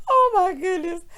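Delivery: high, breathless after laughing; out of laughter. Real, not acted. Host catching breath after her impression, high and giggly. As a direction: still recovering from a real laughing fit.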